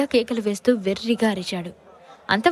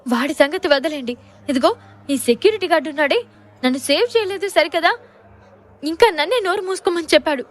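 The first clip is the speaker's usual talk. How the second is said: high, indignant, rising complaint; indignant high-pitched grievance; angry complaint; 5 semitones higher, 4 dB louder than usual. Acted. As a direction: complain angrily to your husband. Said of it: "కోపంగా చెప్పింది".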